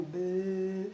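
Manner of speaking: low, soft, slow bored drone; mock-bored sigh of a voice. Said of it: "not just sitting there".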